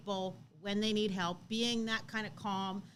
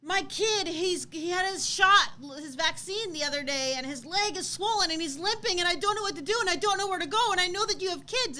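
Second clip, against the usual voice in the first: higher, louder panicked rush; hysterical mother; 8 semitones higher, 6 dB louder than usual. Retold or imitated. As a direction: blurt it out like a frightened mom begging for help.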